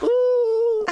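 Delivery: very high, loud mock ooh; hammed-up swooning ooh; crowd ooh. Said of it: "They're like".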